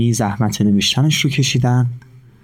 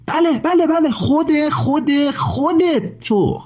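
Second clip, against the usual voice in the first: much higher, eager address; excited. A narrator's reading. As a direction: point at the listener with delight. Narrator turns to the listener.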